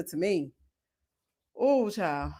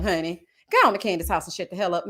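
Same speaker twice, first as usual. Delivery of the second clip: usual speaking voice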